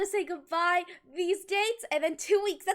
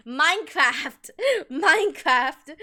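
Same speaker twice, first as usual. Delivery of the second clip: announcing; 9 dB louder, much slower than usual. Real, not acted. Announcing the game.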